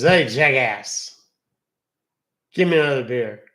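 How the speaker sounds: put-on a drawn-out, loud drunk barking for a beer; drunk bar patron. Drunk guy at the bar shouts for another beer, slow and loud. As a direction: holler it like a drunk regular. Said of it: "the drunk guy looks at the bar and says".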